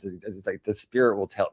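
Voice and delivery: ordinary delivery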